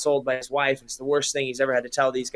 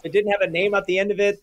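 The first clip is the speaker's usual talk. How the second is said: usual speaking voice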